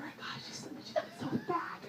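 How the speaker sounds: hushed gossip, then a high squeak; catty whisper. Retold or imitated. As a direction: whisper it behind her back.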